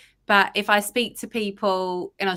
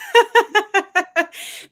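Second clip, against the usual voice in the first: loud, higher burst of laughter; 13 semitones higher, 8 dB louder than usual. Real, not acted. Guest laughing at forgetting it was school holidays. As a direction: laugh out at yourself.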